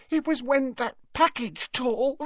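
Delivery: high, tearful voice; sobbing. A narrator's reading. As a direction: get the words out through sobs. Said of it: "sobbed Leanne".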